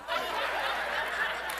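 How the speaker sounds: soft breathy laugh under the breath; soft chuckle. Real, not acted. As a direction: let a quiet laugh slip out. After sly thought.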